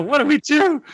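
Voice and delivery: very high mock-helpless plea; hammed-up panic; dismayed disciples. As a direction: wail it in comic dismay. Repeats disciples' plea, mocking.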